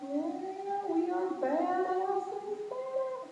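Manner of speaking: high, slow mock-tough boast. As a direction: brag it in jest. Mock boast about pizza.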